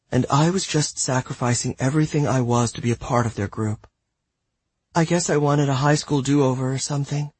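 put-on high, sorry girl's voice; apologetic teen girl. Celia's apology.

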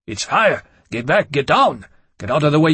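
loud panicked warnings; shouting. A narrator's reading. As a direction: yell at the others to get back. Creatures shout warnings at fire.

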